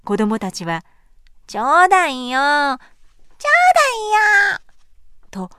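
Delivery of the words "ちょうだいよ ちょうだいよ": put-on whining child. A narrator's reading. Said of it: "だだをこねました".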